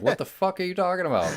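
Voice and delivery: incredulous. Retold or imitated. Acting out Randall's baffled reply.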